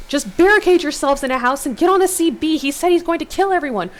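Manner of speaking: high, loud commands; yelling orders into the radio. A narrator's reading. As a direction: shout orders over the radio. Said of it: "you yell".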